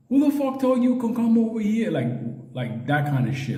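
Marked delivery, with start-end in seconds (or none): 0.1-1.9 gang boss challenge. Retold or imitated. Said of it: "He tells him".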